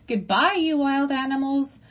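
high, loud, ringing robot goodbye; echoing farewell. Acted. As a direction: call it out to the whole crowd. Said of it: "Roz's voice echoed through the gray mist".

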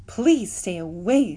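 slow, steady robot voice; measured and mechanical. A narrator's reading. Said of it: "said the robot".

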